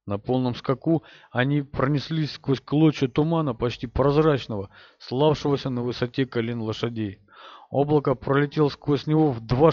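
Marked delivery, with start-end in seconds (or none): none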